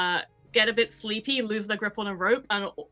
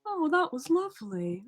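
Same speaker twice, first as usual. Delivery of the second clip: a slightly higher, pleased squid voice; a hint of a contented sea monster; lightly voiced sleepy squid; 7 semitones higher than usual. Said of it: "you hear".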